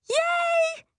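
very high squealed cheer. A narrator's reading. Sister celebrating with mom, cheering. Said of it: "I cheer".

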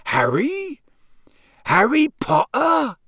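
high, incredulous questioning, the name drawn out; astonished. A narrator's reading. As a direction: marvel at meeting someone famous. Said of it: "his eyes widening".

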